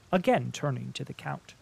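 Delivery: ordinary delivery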